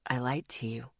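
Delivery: low, very soft confessing whisper. Acted. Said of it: "he whispered".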